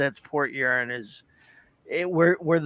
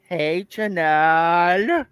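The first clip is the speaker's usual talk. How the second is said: silly high voice; 4 semitones higher, 4 dB louder, much slower than usual. Pretend. Greeting in a put-on voice.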